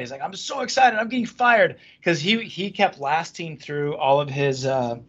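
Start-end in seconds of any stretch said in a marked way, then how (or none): 0.2-1.8 higher-pitched imitation of giddy glee; mock excited. Imitating a friend happily announcing his firing, mock excited. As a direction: play the fired man as oddly thrilled. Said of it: "he actually was super excited".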